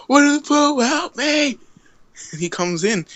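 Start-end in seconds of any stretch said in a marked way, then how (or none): 0.1-1.6 high-pitched mock yell; yelling for help. Hurt storyteller calling Pooh from the bathroom, yelling. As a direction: call out in comic distress, voice way up. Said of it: "and I yell out".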